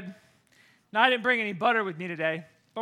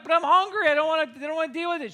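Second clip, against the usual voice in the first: put-on high, fast kid whine; whiny complaint; 7 semitones higher, 5 dB louder, faster than usual. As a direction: whine like a lazy kid, rushing the end.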